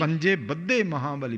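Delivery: ਬੱਧੇ with a doubled, held dh; doubled consonant in ਬੱਧੇ. Shown as a demonstration. Teacher demonstrating the adhak reading, a doubled dh in ਬੱਧੇ. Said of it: "ਇਹਨੂੰ ਅਦਕ ਲਾ ਕੇ ਬੋਲਣਾ".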